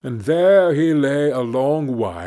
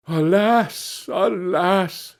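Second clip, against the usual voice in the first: dismayed lament; 6 semitones higher, much slower than usual. A narrator's reading. Hans laments to the butcher over his dry cow, dismayed. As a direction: wail it lightly in dismay.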